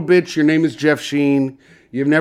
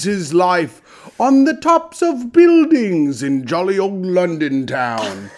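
Cockney chimney sweep; 2 semitones higher, slower than usual. Acted. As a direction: play up a cheery London sweep. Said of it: "I came in talking like this".

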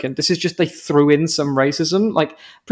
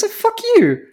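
exasperated. Retold or imitated. Retelling own outraged reaction.

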